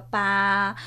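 PA rising in second tone. Shown as a demonstration. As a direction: lift PA upward from the middle like a question.

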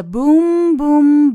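high, loud, drawn-out booms; booming. Acted. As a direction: act out the battle noise with long booms. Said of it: "隆隆隆的这个声音".

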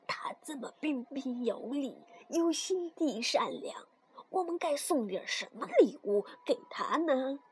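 soft, secretive dwarf talk; hushed conferring. A narrator's reading. Dwarfs secretly discuss a gift for the girl, hushed. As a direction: confer quietly as a little dwarf. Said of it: "悄悄地商量道".

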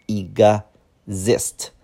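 "exist" slowly split as ig-ZIST. Shown as a demonstration. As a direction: say "exist" in slow parts with a gz sound. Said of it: "这个读x". (慢速拆开读exist，x发gz音)

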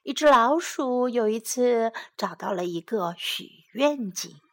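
plain reading voice

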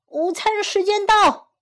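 high, loud announcement; eager fox. A narrator's reading. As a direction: call lunchtime as a hungry fox.

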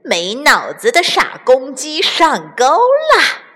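slow, high, mocking villain; sneering triumph, slowly stretched; gloating weasel. A narrator's reading. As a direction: savour the trick, drawing out the words. Weasel gloats while running back.

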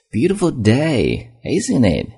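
bright high friendly opener, slow on Beautiful; friendly small-talk tone. Shown as a demonstration. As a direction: chat up a stranger, lifting Beautiful high. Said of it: "这种语气很重要". (像跟陌生人搭话一样，Beautiful 抬高拉长)

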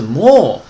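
も held long, pitch sliding down; falling tone on も. Shown as a demonstration. Teacher showing students the pronunciation, も slowly falling. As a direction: draw も out and let it fall. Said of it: "降调的啊".

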